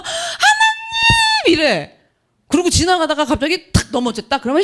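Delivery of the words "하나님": long drawn-out high wail of God's name; crying out to God. Retold or imitated. 돈을 받은 신자가 감격해 하나님을 부르짖으며. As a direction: stretch it into a hammed-up cry.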